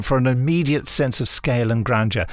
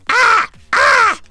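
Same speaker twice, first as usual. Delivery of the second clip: high, loud squawking screech; pterodactyl screech; 8 dB louder than usual. Retold or imitated. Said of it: "the pterodactyl that just goes".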